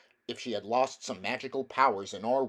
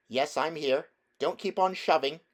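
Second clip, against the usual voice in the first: higher, a touch annoyed; irritated girl; 6 semitones higher, 4 dB louder than usual. A narrator's reading. Polly answering Diggory in the dark, mildly irritated girl. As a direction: snap back lightly.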